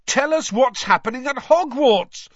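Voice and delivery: very high, loud yell; bellow. A narrator's reading. Boy bellowing out of train window, urgent. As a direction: yell after someone from a departing train. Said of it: "Fred bellowed out of the window".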